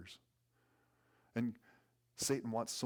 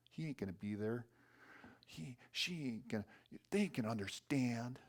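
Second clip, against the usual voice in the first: ordinary talk, nothing marked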